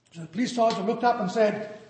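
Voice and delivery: ordinary talk, nothing marked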